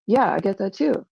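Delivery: ordinary delivery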